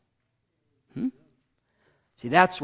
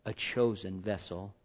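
ordinary talk

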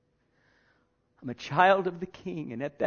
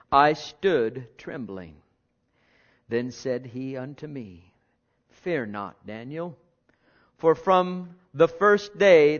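scripture reading; 5 dB louder than usual. Acted. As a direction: read the passage with weight and awe.

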